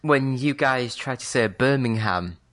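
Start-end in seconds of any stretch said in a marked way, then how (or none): 1.5-2.4 Birmingham with a full HAM at the end; American-style Birmingham. Retold or imitated. Brit quoting how Americans say it, Birmingham with full HAM. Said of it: "put a proper emphasis on the ham".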